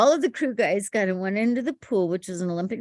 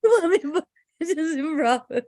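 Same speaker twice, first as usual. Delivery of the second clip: incredulous; 8 semitones higher, slower than usual. Retold or imitated. Panicked disbelief retold.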